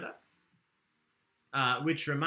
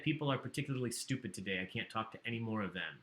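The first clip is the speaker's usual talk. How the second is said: quieter second reading; 5 semitones lower, 9 dB quieter, faster than usual. Shown as a demonstration. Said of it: "not reading it with a horrible accent".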